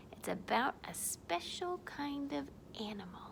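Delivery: soft, near-whispered clue; hushed hint. Pretend. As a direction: drop to a secretive hush. Hinting at a secret animal.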